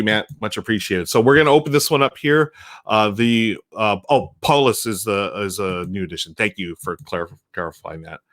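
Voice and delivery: usual speaking voice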